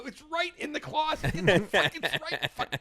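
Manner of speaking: high-pitched mock yell; retold dad's rant, breaking off in frustration; exasperated yelling. Dad sending daughter to the closet, mock exasperated yelling. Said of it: "yelling at my daughter".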